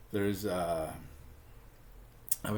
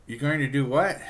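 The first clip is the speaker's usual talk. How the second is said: higher, louder mock disbelief; shocked mom; 5 semitones higher, 5 dB louder than usual. Betty's mom hears her plan, mock shocked. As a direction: imitate a mother taken aback.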